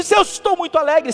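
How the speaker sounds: demonstrating cheerful intonation; very happy demo. Shown as a demonstration. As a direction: lift it up as if very happy. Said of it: "por causa da musicalidade".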